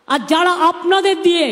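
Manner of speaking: ordinary talk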